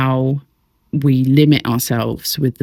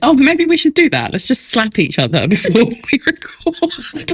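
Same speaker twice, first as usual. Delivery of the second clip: high laughing joke; 8 semitones higher than usual. Real, not acted. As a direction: laugh while suggesting it. Joking, laughing.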